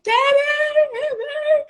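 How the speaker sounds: imitated crying call. Little daughter running to mom crying for daddy, high wail. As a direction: cry out for daddy like a hurt toddler. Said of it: "she's running to me like".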